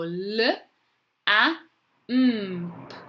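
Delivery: L, A, M, P as separate drawn-out sounds; sounding out letters. Shown as a demonstration. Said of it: "say the letters".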